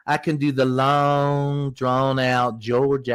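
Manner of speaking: slow, drawn-out Southern Georgia accent. Shown as a demonstration. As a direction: stretch the vowels like a Georgia drawl. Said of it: "Wow, you got it".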